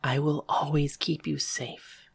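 slow, tender whisper. A narrator's reading. Protector promises to keep her safe, whispering. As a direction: whisper it slowly as a private promise. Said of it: "she whispered to me".

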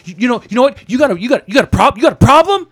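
high, stammering imitation of an aggressor; upset confrontation. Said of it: "significantly upset".

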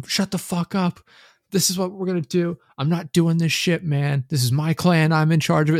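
high-pitched mock rant of an angry gamer; retold angry screaming. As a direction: imitate a bossy clan leader losing it. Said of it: "he sits there and just screaming into the microphone".

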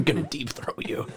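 unvoiced whisper at the milk. Real, not acted. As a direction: whisper it like a secret joke. Joking to the milk.

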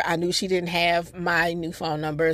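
usual speaking voice

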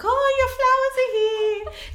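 high, drawn-out, loud excited exclamation; excited photographer. Retold or imitated. Photographer announcing the flowers to the bride, excited. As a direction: sing out the news in an excited high voice. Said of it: "he's all excited".

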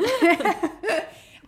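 high loud burst of laughter; big high laugh. Real, not acted. Laughing at mix-up.

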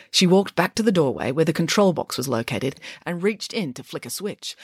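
plain reading voice

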